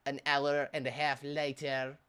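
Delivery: slow time-card voice with a put-on French accent; mock French-accented narrator. Narrator marking time passed during the video, mock French accent. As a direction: announce it like a French cartoon narrator, slowly.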